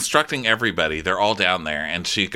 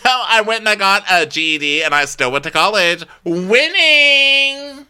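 much higher, loud gloating; imitating a smug brag; mock triumphant boast; 10 semitones higher, 5 dB louder than usual. Camille bragging she still went to college, triumphant.

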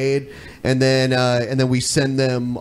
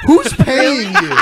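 laughing; 8 semitones higher, 5 dB louder, much slower than usual. Real, not acted. Laughing accusation of co-host.

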